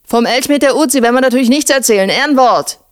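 high, quick mock bellow; calling across. A narrator's reading. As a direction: call it over to someone some way off. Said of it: "Erkan brüllte zu Schwarze hinüber".

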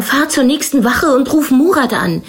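high, urgent order; narrated shout. A narrator's reading. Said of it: "schrie Erkan". (drängend und erregt rufen)

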